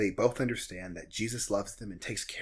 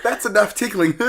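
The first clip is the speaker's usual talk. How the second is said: imitating uncomfortable actor; 8 semitones higher, 10 dB louder than usual. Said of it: "he's like".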